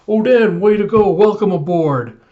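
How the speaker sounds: loud, high group cheer that settles at the end; crew cheering. Retold or imitated. Pirates welcoming Oden aboard, loud cheering.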